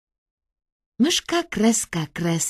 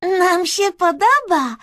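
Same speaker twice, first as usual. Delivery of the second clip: high, loud gleeful character voice; Kreska, amused; 11 semitones higher, 7 dB louder than usual. A narrator's reading. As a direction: answer back high and merrily. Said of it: "zaśmiała się Kreska".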